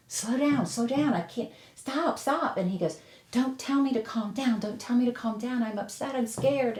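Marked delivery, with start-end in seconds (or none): 0.1-2.6 retold urgent plea. Calming a yelling caller, retold. 3.3-6.8 higher, mock yelling, upset and scared; retold frantic yell. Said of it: "he was just yelling in the phone".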